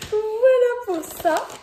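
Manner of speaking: lightly put-on high, slow silly voice; giggly tired put-on voice; silly Pitifie voice. Vlogger slips into her tired silly voice for viewers. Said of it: "quand je fais ma voix de Pitifie".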